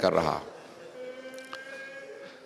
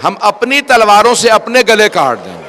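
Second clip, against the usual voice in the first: higher and louder peak on اپنے; loud pledge; 7 semitones higher, 9 dB louder than usual. Acted. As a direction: throw the vow out at full voice. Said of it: "آواز دی".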